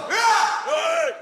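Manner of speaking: high, loud wordless yell; shouted yell. Pretend. Comic trading shouts with an audience member, playful yell. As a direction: bellow back in a playful shouting match. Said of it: "We'll carry this on".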